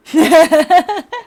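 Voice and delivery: bursting out laughing; big loud laugh. Real, not acted.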